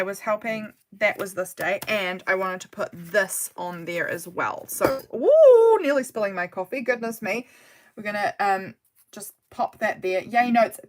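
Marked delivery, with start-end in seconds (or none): 5.1-5.8 high, loud "ooh" of alarm; startled ooh. Real, not acted. Nearly spilling the coffee, a startled high ooh. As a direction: whoop a sudden, held "ooh".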